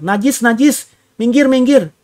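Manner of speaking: high, strained warning cry of a leper; a leper warning passers-by, high and pleading; leper calling out. Retold or imitated. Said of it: "harus teriak itu".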